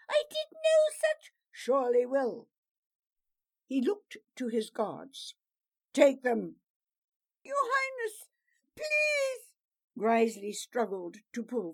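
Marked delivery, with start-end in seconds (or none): 0.1-2.5 high, loud, desperate protest; accused man crying out his denial; pleading. Acted. 5.9-6.6 loud, curt order; captain ordering the prisoners away; command. Acted. 7.4-9.5 high, loud, crying plea; desperate plea. Acted. Prisoner begs the princess.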